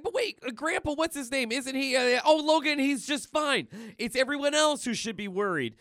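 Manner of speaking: high, loud comic-book dialogue; hammed-up excited characters on the run; agitated back-and-forth. Maggie and Deadpool exchange voiced.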